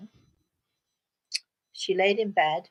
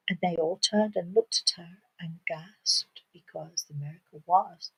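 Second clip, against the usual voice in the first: plain reading voice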